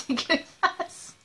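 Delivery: slow, drawn-out disgusted laugh. Real, not acted. Disgusted reaction after tasting. (dégoût en riant, traînant)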